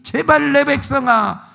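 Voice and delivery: God's voice pleading loudly with his people; crying out. Retold or imitated. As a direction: call out to the people, begging. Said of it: "외치는 거예요".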